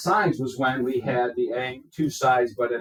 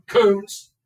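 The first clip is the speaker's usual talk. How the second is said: raised, higher call to a pupil; calling out sharply; 8 semitones higher, 8 dB louder than usual. Real, not acted. Teacher calling an inattentive student to listen, calling out. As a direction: call the name out loud for attention.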